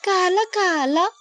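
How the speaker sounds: put-on high bleating little kid goat; small timid goat, high up. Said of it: "小山羊小声地回答".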